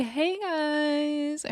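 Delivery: high, soft breezy hello; airy greeting. Retold or imitated. Scotty sweeping in to greet the girls, high and soft.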